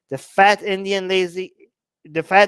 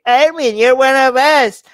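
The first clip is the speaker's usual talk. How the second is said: higher, louder mocking fan voice; mock nationalist fan; 6 semitones higher, 5 dB louder than usual. Mocking North Indian fans who tell him he's one of them, hammed-up. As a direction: ham up a pushy fan claiming him. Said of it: "the ones that keep telling me".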